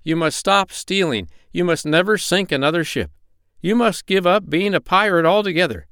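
high, emphatic doctor's orders; stern doctor. A narrator's reading. Doctor lays down terms.

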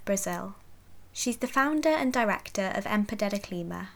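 measured, scripted host introduction; podcast intro read. Real, not acted. Host introduces guest and episode to listeners, podcast intro read.

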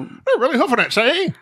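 put-on high old-time race caller; old-timey announcer. Host jokes about the horse race, old-timey announcer voice. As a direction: play a vintage racetrack wise guy.

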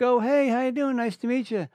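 lightly mocked cheery greeting; mock chirpy greeter.

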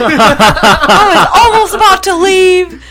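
imitated outraged yell, very slow, high and loud; MJF. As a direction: stretch out the mock complaint at full volume. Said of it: "And he's like".

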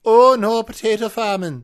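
high, loud cartoon Irish cry; panicked mock-Irish shout; Irish accent. Shown as a demonstration. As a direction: yell in a hammed-up Irish accent. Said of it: "of the Irish touchstone".

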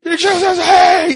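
high, loud, slow holler; loud imitated greeting. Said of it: "It's loud".

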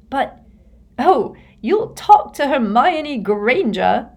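accusing shrill cry, slowed. A narrator's reading. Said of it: "she said shrilly".

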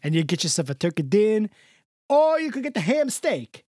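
put-on crooning old Italian charmer, laying it on thick; Mister Del Rossi. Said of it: "I gotta get the old man in here".

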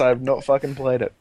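normal talking voice

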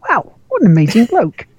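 mock-impressed reader of a bathroom wall; mock amazement. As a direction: gush in fake admiration. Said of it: "and you go".

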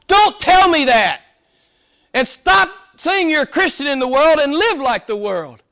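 high, loud protest; John's exclamation. Retold or imitated. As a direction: beg them to stop. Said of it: "It's really what John is saying".

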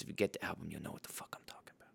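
quick whisper, almost under the breath; whispered aside. Real, not acted. To listeners who know the album, a quick whisper. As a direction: let it slip out as a fast hushed aside.

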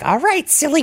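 high, far, imitating Marge Simpson. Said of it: "the Marge Simpson voice there".

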